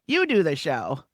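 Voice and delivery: lightly voiced, casual star brushing it off; Roseanne Barr impression. Star phones in from home telling producer to host, lightly imitated. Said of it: "excellent Roseanne Barr impression".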